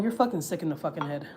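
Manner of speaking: ordinary delivery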